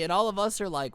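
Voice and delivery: usual speaking voice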